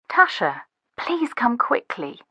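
thin, distant telephone voice; urgent plea heard through a receiver; crying into the phone. A narrator's reading. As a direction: plead softly down a phone line. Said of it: "Lily cries into the phone".

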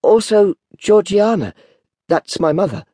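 put-on high, nervous child's voice; mildly frightened boy; timid explanation. A narrator's reading. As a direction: explain meekly to the convict. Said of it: "I timidly explained".

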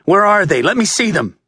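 desperate father pushing through; frantic. A narrator's reading. Frantic father at crime scene.

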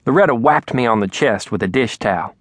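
ordinary delivery, nothing marked

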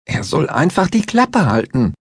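high, insistent angry demand; energetic repeat. A narrator's reading. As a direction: hammer it home, fed up. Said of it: "wiederholte Besmer energisch".

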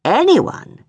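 "Anyone" hit high and held; "Anyone" pushed up, stressed; emphatic. A narrator's reading. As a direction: lean hard on "Anyone". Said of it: "he said emphatically".

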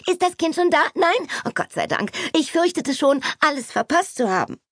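put-on high, chattering society girl in a flurry; Kitty bursts in, scolding the maid and her sister, high and excited; excited young Kitty. A narrator's reading. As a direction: rattle it off high and breathless with excitement. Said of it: "ihre aufgeregte Stimme".

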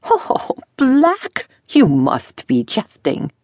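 incredulous dressmaker. A narrator's reading. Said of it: "He chuckled".

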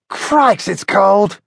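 Billy voiced very high, mild cry about the cold; Billy shivering, crying out mildly in a high voice; cried out. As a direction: exclaim at the cold in a high bird voice. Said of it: "cried Billy".

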